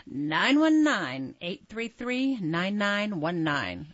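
slow, booming announcer read; radio voice. Shown as a demonstration. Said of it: "I wanted to use my radio voice".

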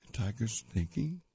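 ordinary delivery, nothing marked

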